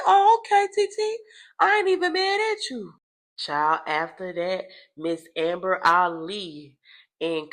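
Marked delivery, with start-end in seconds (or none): 0.1-2.9 high, squealing laugh-through reply; playful high-pitched giggly quote. Retold or imitated. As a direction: laugh it off, amused and unbothered. Her own amused reply retold.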